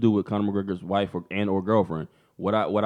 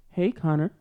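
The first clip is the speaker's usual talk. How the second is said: higher-pitched mock fangirl greeting; 5 semitones higher than usual. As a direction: call out as a flirty pop star, playfully.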